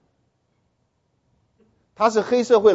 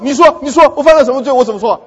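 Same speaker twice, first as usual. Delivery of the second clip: higher, louder combative challenge; fierce demand; 4 semitones higher, 8 dB louder than usual. Retold or imitated. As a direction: corner him with the question. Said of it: "所以他那么凶了".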